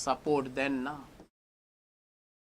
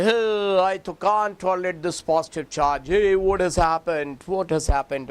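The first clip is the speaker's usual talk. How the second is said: loud, high, alarmed cry of a molecule; panicking oxygen; 4 semitones higher, 6 dB louder than usual. Acted. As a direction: play the oxygen atom shouting in alarm at its charge. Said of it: "Now oxygen will say".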